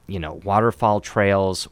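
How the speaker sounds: ordinary talk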